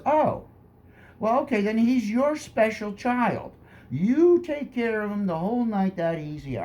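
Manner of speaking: high, mock-sage pastor voice, pitched well up; imitating the pastor. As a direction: play the pastor handing off the problem kid, high and breezy. Pastor voiced in retold story.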